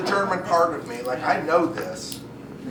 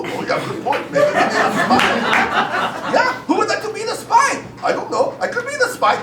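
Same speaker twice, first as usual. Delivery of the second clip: put-on very high, loud German accent; hammed-up German tribesman; German tribe member; 5 dB louder than usual. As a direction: pipe up with a squeaky German accent. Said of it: "it was German tribes they were talking about".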